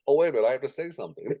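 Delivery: high, fast and flustered; mock startled realization. Lulled announcer remembers he must speak, mock fluster. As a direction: act suddenly jolted out of a daydream. Acting out sudden snap from lull.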